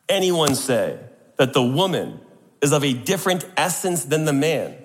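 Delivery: ordinary delivery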